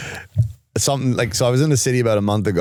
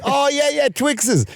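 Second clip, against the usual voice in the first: much higher, eager squeal; mock eager; 12 semitones higher, much slower than usual. Imitating the woman grabbing Twixes, mock eager squeal. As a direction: imitate her jumping at the candy.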